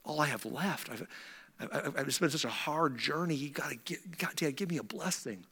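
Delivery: soft, halting mock plea; begging and weeping. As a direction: plead quietly with Dad, lightly acting tearful. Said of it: "begins to just beg and weep and say".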